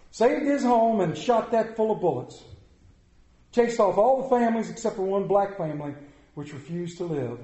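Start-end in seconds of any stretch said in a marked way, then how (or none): 0.1-5.3 loud, high, driving sermon delivery; preaching at full force. Acted. Preacher rousing the church with Clarence Jordan's story, full force. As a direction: preach it hard to the congregation.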